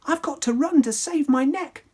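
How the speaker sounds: high panicked shout; high alarmed cry. Acted. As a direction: shriek it as midnight strikes. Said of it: "She shouted".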